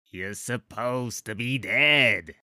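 slow, choked troll words through a squeezed throat; gurgled. Acted. Troll held by the throat, gurgling. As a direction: gurgle it out while being strangled. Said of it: "gurgled the troll".

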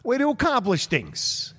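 mock Virginia cheer; mock Virginia accent. Cheering on Mitch in a high mock Virginia accent. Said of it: "is that a Virginia accent we all know?".

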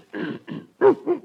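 a high, loud throat clear that sounds like a dog's bark. Acted. Nervous dog catcher clears his throat to Riley, high and barky. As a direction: clear the throat so it comes out like a yelp. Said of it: "I think a dog got in the house".

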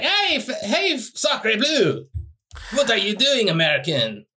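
high, hammy French chef voice; exaggerated French accent, very high. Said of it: "and he was like".

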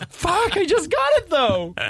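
high, slow, whiny complaint; mock frustration. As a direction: ham up the disappointment, pitched high. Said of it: "story of my life".

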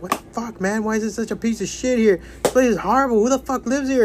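high-pitched mock outrage at a horrible place; incredulous complaining. Friend griping about Baltimore, high incredulous complaint.

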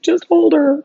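high, pleading mock-tearful voice; imitated tearful plea. Sobbing partner urging her to hold girl, imitated high plea.

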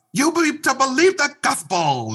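hammed-up child imitation of a sermon; kids mocking preacher dad. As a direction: kids at home parroting dad's preaching, high mock sermon. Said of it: "make fun of their dad preaching".

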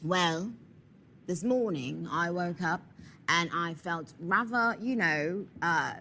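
put-on low, measured English accent. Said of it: "give me your English accent".

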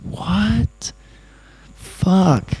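high-pitched "what?", then a high falling curse; retold stunned disbelief, high and punchy; astonished. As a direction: react in mock astonishment, pitch jumping up.

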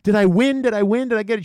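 imitating an eager young caller; excited winner. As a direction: bounce up high, hoping she won. Winner voiced in story.

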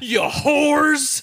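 high-pitched yelled movie quote; exaggerated, high shouted accusation; mock shout. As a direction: holler it like an outraged film character.

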